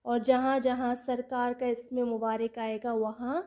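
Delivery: usual speaking voice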